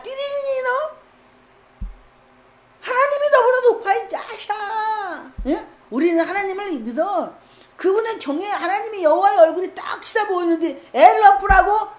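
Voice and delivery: high, scornful rebuke of a king; defiant scolding. Acted. Midwife defying the Egyptian king over killing babies, high scornful scolding. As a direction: midwife telling off the king, mocking and fierce. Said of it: "이렇게 했을 수도 있어요".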